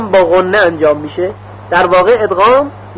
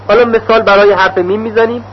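usual speaking voice

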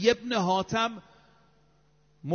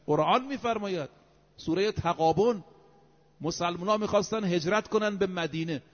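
ordinary talk, nothing marked

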